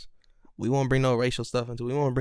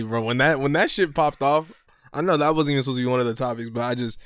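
usual speaking voice

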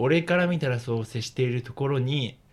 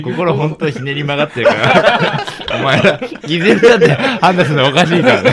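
loud laughing protest; 7 semitones higher, 12 dB louder than usual. Real, not acted. Laughing, protesting friends' cynicism.

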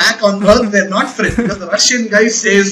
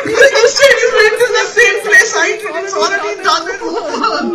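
laughing; 11 semitones higher than usual. Real, not acted. Laughing defence of the spoiler.

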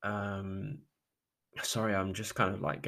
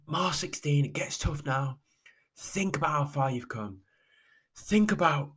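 lightly voiced race commentator, higher pitch; commentator's encouragement; 5 semitones higher than usual. Race commentator to runner at mile sixteen, higher-pitched encouragement. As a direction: gently urge the runner on, pitched up. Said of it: "she just goes".

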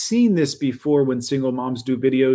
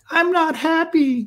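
high, slow mocking whine; a hammed-up sulky single mom voice; mock whiny mom; 4 dB louder, slower than usual. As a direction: parody her in a high put-on voice. Mocking the single mom's talk.

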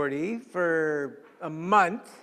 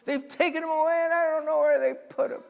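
distraught Mary; 10 semitones higher, 5 dB louder than usual. Acted. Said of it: "she's weeping".

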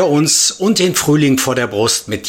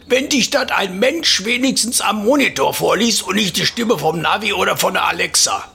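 put-on teasing old man, higher; old neighbour voice; 8 semitones higher than usual. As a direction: needle the younger man. Opa teasing narrator again.

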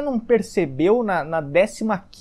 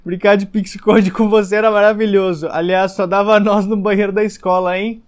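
mock flirty reply; 6 dB louder than usual.

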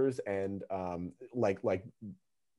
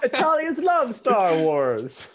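put-on high, slow hammy Italian accent; 13 semitones higher, 8 dB louder, slower than usual. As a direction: lean hard into the Italian accent. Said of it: "why do you have an Italian accent".

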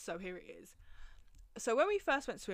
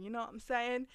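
ordinary talk, nothing marked

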